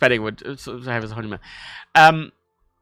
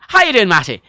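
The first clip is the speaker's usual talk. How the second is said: high, loud Dublin greeting with "Matty"; Dublin accent; 8 semitones higher, 6 dB louder than usual. Shown as a demonstration. Showing Matty in Dublin, Dublin accent. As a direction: run it together like a Dubliner. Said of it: "in a dub accent".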